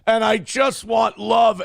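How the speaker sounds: mocking the streamer crying on stream. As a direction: ham up a self-pitying crybaby. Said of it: "He's crying because".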